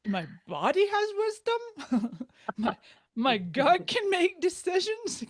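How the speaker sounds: high, slow, baffled questioning; incredulous disbelief. Retold or imitated. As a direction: ask it like the idea is absurd. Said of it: "kinda looking down on my navel and going".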